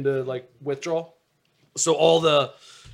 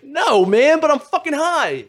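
high, loud retold protest; exasperated yell; 14 semitones higher, 13 dB louder, slower than usual. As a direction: yell it back down the phone. Protesting to friend on phone.

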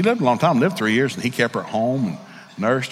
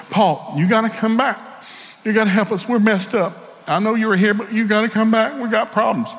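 higher-pitched desperate plea from the Corinthians; pleading church members; 8 semitones higher than usual. Acted. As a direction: beg Paul to come back.

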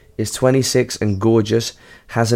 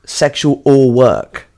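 slow, clear correction leaning on "or"; stress on or; 3 semitones higher, much slower than usual. Real, not acted. Host corrects co-host who misheard, stressing "or" slowly. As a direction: say "Sexual or work" slowly, hitting "or". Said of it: "Sexual work?".

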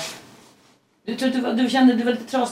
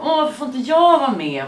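higher, louder mock complaint; left-out protest; 7 semitones higher, 5 dB louder than usual. Speaker voicing feeling shut out of football, mock protest. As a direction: act out an indignant plea to be included.

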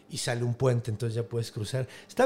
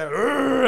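lion roar; 10 semitones higher, 5 dB louder than usual. Acted. Lion roars at hero.

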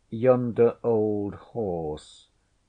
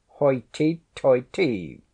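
louder, sharp indignant exclamation; angry; 5 semitones higher, 7 dB louder than usual. A narrator's reading. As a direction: snap it out in offended pride. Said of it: "the lady began angrily".

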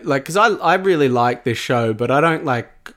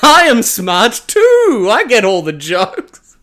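smug laugh; 7 semitones higher, 7 dB louder, much slower than usual. Retold or imitated. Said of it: "they're like".

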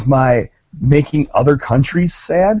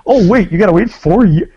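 much higher, fake-chipper congratulations; mock oblivious; 9 semitones higher than usual. Joking with friends about France, mock chipper and oblivious. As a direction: gush like you never heard of soccer. Playing oblivious American fan.

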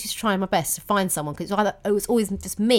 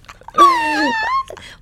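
high squealing laugh, much louder; shrieking laugh; 6 dB louder than usual. Real, not acted. Laughing at her own daddy's girl joke, shrieking laugh. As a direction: let a long squeal of laughter out. Laughing hard at the dad remark.

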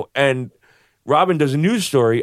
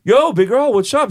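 hearty yo greeting; 8 semitones higher, 4 dB louder than usual. Retold or imitated. Greeting the towering bouncer outside.

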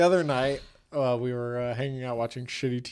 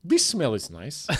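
slow mock Ukrainian accent; Natalie. Bride with nose in tree bark, slow Ukrainian accent. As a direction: savour it in a comic Ukrainian accent. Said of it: "She talks like this".

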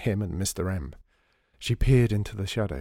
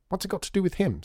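a sharp, higher snap at her father; snapped; 11 semitones higher, faster than usual. A narrator's reading. Lydia snapping at her father about Mr Krebs, higher. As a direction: bite the question off, irritated. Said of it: "snapped Lydia".

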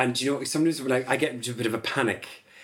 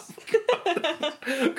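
squeaky mock protest, much higher; high embarrassed stop. Real, not acted. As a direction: cut them off, embarrassed. Embarrassed at sounding wholesome.

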